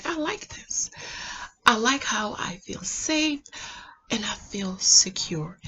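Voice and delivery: hushed, slow inner voice; thinking to oneself. Retold or imitated. Woman recalling feeling safe with him, hushed inner thought. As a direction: murmur it like a private thought. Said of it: "I thought to myself".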